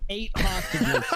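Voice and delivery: words breaking up with laughter; laughing. Real, not acted. [name] laughs while telling the negotiator about the hostages. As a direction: try to stay serious but laugh through it.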